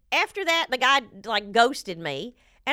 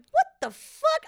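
outraged disbelief; 3 dB louder, slower than usual. Retold or imitated. Outraged retort to the therapist.

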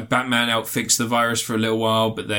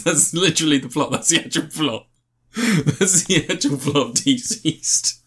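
laughing, higher-pitched insistence; amused disbelief; 5 semitones higher than usual. Real, not acted. Host swears to co-host the silly plot is real, amused disbelief. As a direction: laugh through it, pitch raised. Cracking up at absurd comic plot.

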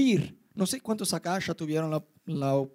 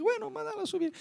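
much higher, softer past self; own past voice; 14 semitones higher, 5 dB quieter than usual. Retold or imitated. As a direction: toss off the order lightly. Retells own past reply. (su voz de antes, aguda y suave)

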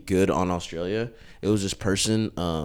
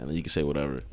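ordinary talk, nothing marked

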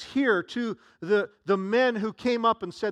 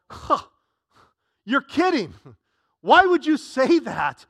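louder, higher incredulous disbelief; incredulous crowd; 5 semitones higher, 8 dB louder than usual. Retold or imitated. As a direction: scoff like a doubting mourner. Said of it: "they go".

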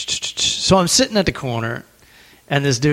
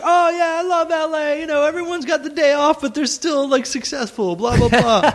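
high mocking imitation of a talker; chattering bar stranger; 13 semitones higher than usual. Stranger at the bar rambling about LA, high mocking voice.

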